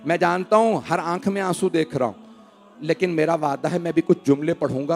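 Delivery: ordinary delivery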